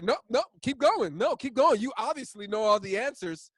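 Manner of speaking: sarcastic foreman. Retold or imitated. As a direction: needle the know-it-all, loud and high. Foreman mocks know-it-all apprentice.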